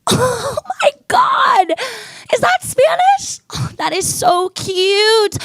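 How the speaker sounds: high, slow, squealing gush. Acted. Mocking her own gushing over a Spanish call, high squeal. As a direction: squeal like it's adorable. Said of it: "I'm like".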